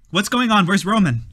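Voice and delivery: high, loud startled voice; startled young man asking; alarmed Alan. Acted. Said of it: "and he says".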